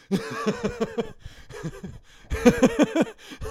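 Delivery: a burst of high, loud laughter. Real, not acted. Cracking up after ranting about city people, high laugh. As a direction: laugh off the rant.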